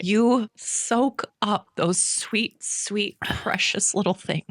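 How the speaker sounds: slow tender urging, drawn out. Real, not acted. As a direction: linger over sweet. Urging a young mom.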